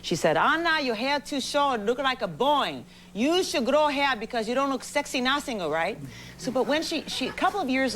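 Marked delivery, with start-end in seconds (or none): 0.4-6.0 lightly imitated Japanese-accented mother, slow; a hint of mom's thick Japanese accent scolding; gentle imitation of a Japanese mother's accent. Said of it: "she's a very thick accent".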